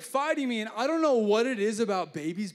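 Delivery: ordinary delivery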